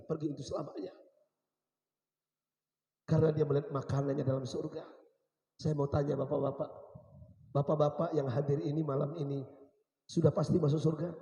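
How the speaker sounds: usual speaking voice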